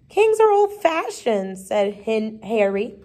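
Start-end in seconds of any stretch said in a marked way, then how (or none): 0.1-1.6 put-on high child's voice, protesting; higher-pitched schoolboy objection; objecting pupil. A narrator's reading. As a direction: pipe up to contradict the teacher. Boy argues with teacher.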